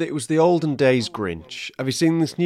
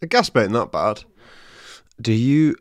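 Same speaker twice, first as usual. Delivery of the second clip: usual speaking voice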